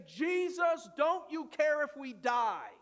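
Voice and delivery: frightened shout of drowning men; panicked cry. Acted. Disciples yelling at sleeping Jesus in panic, frightened shout. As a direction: cry out to him in terror above the storm. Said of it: "they begin to scream and cry out".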